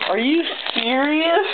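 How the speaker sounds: high, slow are you serious; pure disbelief; real amazed rise; stunned squeak. Real, not acted. Another rare card appears.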